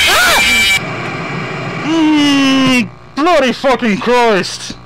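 high, loud scared yelling; real fright, screaming curses; startled scream. Real, not acted. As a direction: shriek from a jump scare.

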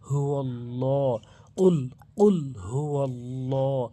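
slow, drawn-out tajweed chant; slow Arabic recitation; Quranic recitation. Shown as a demonstration. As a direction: recite it slowly with full lengthening.

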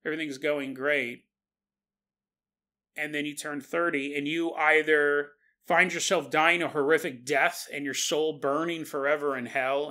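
ordinary talk

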